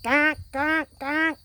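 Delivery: high, loud honking duck call; the wary big duck honking from afar. Retold or imitated. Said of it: "The big duck says".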